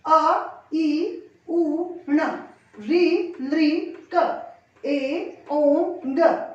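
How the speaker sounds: each sound said clearly and separately; careful pronunciation. Shown as a demonstration. Teacher pronouncing each letter for students, clear and separate. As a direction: sound out every letter distinctly for the class. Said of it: "आप लोगों के सामने उच्चारित करती हूँ".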